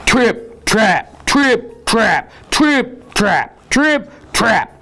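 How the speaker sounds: "trip" and "trap" each punched high and separate; heavy trip-trap footsteps. A narrator's reading. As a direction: stamp out every "trip, trap". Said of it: "was so heavy that the bridge".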